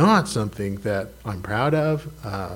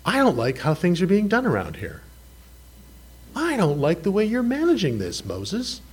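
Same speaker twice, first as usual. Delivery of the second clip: complaining Israelites; 6 semitones higher than usual. Retold or imitated. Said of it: "they were saying".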